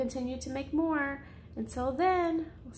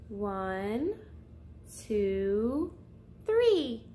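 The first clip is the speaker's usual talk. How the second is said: counting to three; 5 semitones lower, much slower than usual. Acted. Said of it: "I think I'll count to three".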